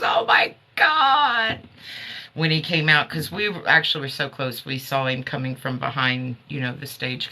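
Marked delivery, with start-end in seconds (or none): 0.0-1.6 high, slow mock shout of delight; imitating a scream. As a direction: echo friend's thrilled cry in a half-hearted shout. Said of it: "She goes".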